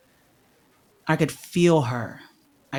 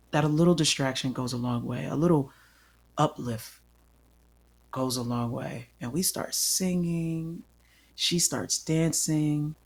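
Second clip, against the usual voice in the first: ordinary delivery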